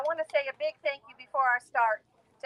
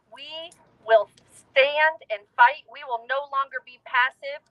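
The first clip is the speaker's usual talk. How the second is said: rally speech; 4 dB louder than usual. Real, not acted. Rally call to fight.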